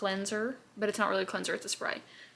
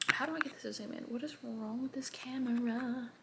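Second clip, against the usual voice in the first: whispered grumble; 3 semitones higher, 7 dB quieter than usual. Real, not acted. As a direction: mutter under your breath at the camera. Muttering at camera zoom.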